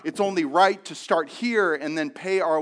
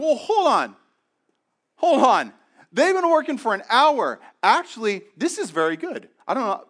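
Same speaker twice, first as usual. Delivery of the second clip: high, eager, getting more thrilled; excited workers; 6 semitones higher, 3 dB louder than usual. Acted. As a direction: workers counting their windfall, gleeful. Workers realize they'll get more.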